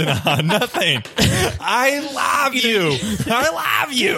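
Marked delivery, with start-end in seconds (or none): 1.2-4.2 high-pitched LOVE with an odd broad vowel; oddly said love. Acted. Cornered partner protesting to girlfriend, love said oddly. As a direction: push LOVE high and mispronounce it as "lahv". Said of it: "Why are you saying love?".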